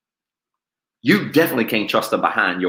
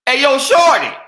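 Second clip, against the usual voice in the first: mock catcall; 13 semitones higher, 8 dB louder than usual.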